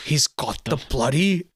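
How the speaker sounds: slow mock British villain line; drawn-out British accent, relishing it. Imitating Sean Bean, slow British accent. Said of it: "he's just like".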